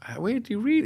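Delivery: high, disbelieving question; can't believe what you're hearing; a startled, puzzled ask; incredulous. Retold or imitated.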